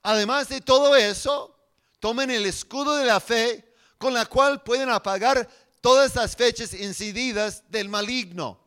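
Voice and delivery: loud, high, forceful scripture preaching; prédica a plena voz. Acted. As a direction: proclaim the verses to rally the congregation. (proclamando la Escritura con fuerza)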